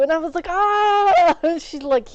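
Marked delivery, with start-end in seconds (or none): 0.5-1.4 a short high loud mock shriek; mock startled shriek. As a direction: yelp in fake alarm at the news. Reacting to shark tooth remark.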